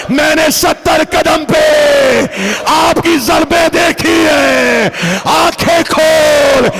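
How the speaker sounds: high, slow, drawn-out shouted lament; grieving sister wailing. Acted. Zainab calling to her slain brother, wailing cry. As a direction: cry out each phrase at full force.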